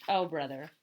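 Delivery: usual speaking voice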